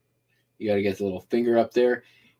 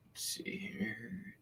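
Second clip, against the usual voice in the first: whisper; 8 semitones higher, 19 dB quieter, much slower than usual. Real, not acted. Talking to self while shipping.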